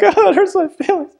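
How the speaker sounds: very high falsetto whine with a laugh; hammed-up fake tears, way up high; mocking a manipulative crybaby, shrill and whiny; mock passive-aggressive crier. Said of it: "being like".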